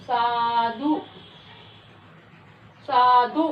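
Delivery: साधु sounded out to end on a clear oo; final उ sound. Shown as a demonstration. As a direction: let the last oo of साधु ring out for pupils. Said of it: "last में किसकी आवाज आ रही है?".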